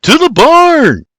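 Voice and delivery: long, high, loud holler; shouted direction. A narrator's reading. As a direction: shout where to go, drawn out. He points.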